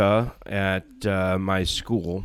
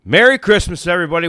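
high, loud festive hello; excited greeting; 9 semitones higher, 6 dB louder, faster than usual. Real, not acted. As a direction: burst in with holiday cheer. Cheerful show opening greeting.

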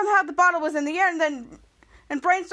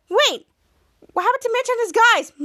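higher-pitched, urgent question; alarmed interruption; 7 semitones higher, 4 dB louder than usual. Acted. Shadow asks after the villains, alarmed. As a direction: cut in over a celebration.

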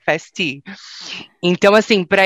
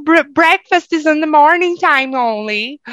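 slow, higher careful English; her own stiff English; 7 semitones higher, 4 dB louder than usual. Retold or imitated. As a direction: retell your naive correction. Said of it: "aí eu falava". (imitando a si mesma em inglês, devagar)

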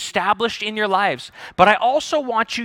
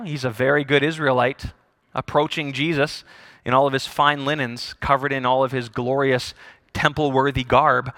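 usual speaking voice

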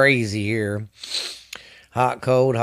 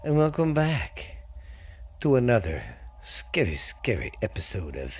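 spooky host voice; 2 semitones lower, 5 dB quieter than usual. Acted. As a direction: welcome listeners like a campfire ghost storyteller. Spooky podcast host intro.

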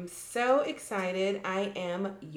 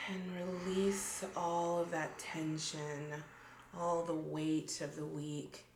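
grounding meditation; 7 dB quieter than usual. Shown as a demonstration. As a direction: gently guide the breathing. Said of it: "why don't we do a little, like, grounding meditation".

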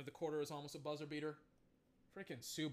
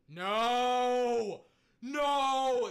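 high, loud dismayed shout; 7 semitones higher, 12 dB louder than usual. Real, not acted. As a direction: yell it out in disbelief. Discovers shows removed.

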